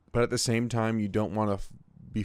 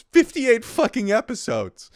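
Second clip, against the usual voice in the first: exclaiming; 13 semitones higher, 8 dB louder, slower than usual. Real, not acted. Exasperated emphasis.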